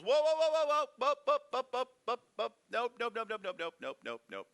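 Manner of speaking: very high, loud whoas, then nopes calming down; frantic angel stop. Retold or imitated. The angel halts Abraham's knife, frantic shout fading to calm. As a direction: yank the brakes on him, urgent then settling. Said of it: "And the angel goes".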